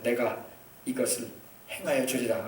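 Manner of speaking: low, hushed, measured divine voice; deep, quiet voice of the Lord; the Lord's promise. Acted. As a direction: speak God's promise low and soft, with pauses. Continues voicing the Lord's promise.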